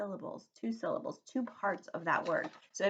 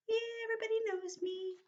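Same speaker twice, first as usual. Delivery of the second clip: very high, quick little voice; put-on high-pitched boast; showy famous squeal; faster than usual. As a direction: squeal it like a pretend celebrity. Acting out the word famous.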